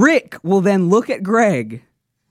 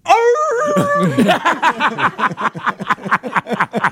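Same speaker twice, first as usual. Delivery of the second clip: long high mock hound howl; bay like a hound; high drawn-out "arooo"; bloodhound howl; 8 semitones higher than usual. Bloodhound howl breaks into the story.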